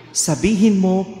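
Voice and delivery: normal talking voice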